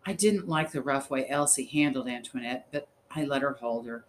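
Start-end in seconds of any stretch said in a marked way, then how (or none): none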